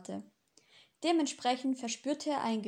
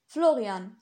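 a sharp, louder interruption; cutting in loudly; 8 dB louder than usual. A narrator's reading. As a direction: cut him off with the name, louder. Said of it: "fiel er ihm ins Wort".

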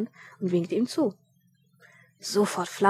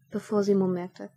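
usual speaking voice

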